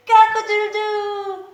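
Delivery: high crowing cock-a-doodle-doo; rooster crow. Shown as a demonstration. Teacher crowing for the kids like a rooster. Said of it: "a rooster".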